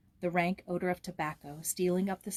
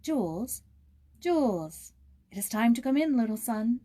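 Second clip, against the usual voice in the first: put-on calling a child in from the lawn, rising louder; 4 semitones higher, 4 dB louder than usual. A narrator's reading. A mother calling her toddler in at dusk, calling out. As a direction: call across the lawn to a little boy. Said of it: "was calling".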